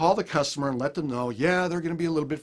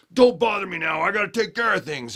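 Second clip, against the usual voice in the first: loud, higher, brushing-off snap; irritated dad; 7 semitones higher, 8 dB louder than usual. Retold or imitated. Dad brushing off his kids after a bad day, loud irritated snap. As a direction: shove the kids away with your voice.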